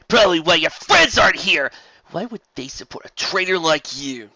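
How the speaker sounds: loud scornful taunt; mocking jab. Spitfire mocking Rainbow Dash's absent friends, loud scorn. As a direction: twist the knife.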